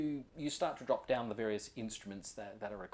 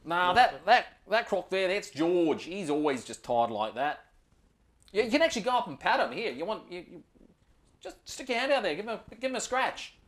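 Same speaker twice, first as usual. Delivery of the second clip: higher, louder easygoing Aussie ranger giving a tour; 6 semitones higher, 7 dB louder than usual. Acted. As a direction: play the laid-back outback ranger showing the spot. Ranger shows off tame croc.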